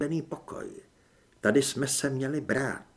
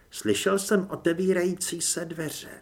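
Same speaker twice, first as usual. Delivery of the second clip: ordinary delivery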